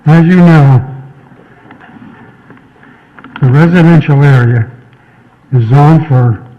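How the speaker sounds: winded, gasping between phrases; out of breath. Real, not acted. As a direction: push through being short of breath. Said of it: "I gotta catch my breath".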